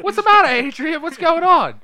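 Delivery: very high, loud hammed-up hollering; mock shouting. Brother over his collapsed sister, loud high mock shouting. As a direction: holler it in exaggerated panic. Imitating yelling at fallen Adrian.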